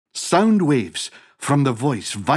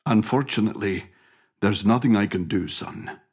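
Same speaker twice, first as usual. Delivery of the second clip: low, quick, subdued reply; resigned father; 6 semitones lower, 4 dB quieter than usual. Acted. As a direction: lower the voice and let it fade on the last word. Father answers helplessly.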